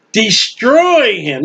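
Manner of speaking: destroy drawn out slowly, each syllable leaned on; slow and heavy on destroy, falling at the end; stress on destroy. Shown as a demonstration. Preacher to congregation, leaning hard on destroy. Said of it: "Get that strong and underline that word, destroy".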